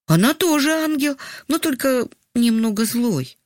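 put-on high-pitched childlike voice; little boy. A narrator's reading. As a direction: answer Mum as a small boy, pitched high. Narrator voices the boy answering mother. (детский голос, высоко)

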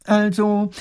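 halting, loud official voice full of ums; a fussy minister stumbling through his report; lightly voiced fussy minister; hesitant minister.

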